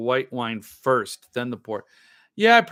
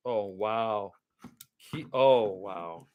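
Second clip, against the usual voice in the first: usual speaking voice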